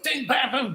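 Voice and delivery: "ding, bam, boom" in sharp beats; punchy rhythm. Shown as a demonstration. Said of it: "they're giving us this rhythm".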